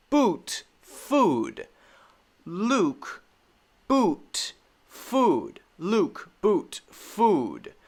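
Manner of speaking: "Luke", "boot", "Food" each with a stretched ooh; teacher drilling long u words. Shown as a demonstration. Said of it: "the long U sound".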